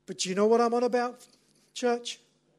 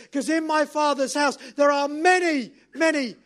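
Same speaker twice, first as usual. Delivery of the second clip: loud, raised preacher's voice; impassioned preaching; 6 semitones higher, 9 dB louder, slower than usual. Acted. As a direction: preach it with full force as the groom's vow to his bride. Preaches at full force.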